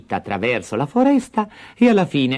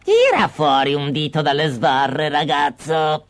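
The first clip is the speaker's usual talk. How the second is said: lightly voiced old witch; coaxing hag at the cage; a touch of witch voice; 3 semitones higher than usual. The witch asks Hansel for his finger, coaxing. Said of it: "gli diceva".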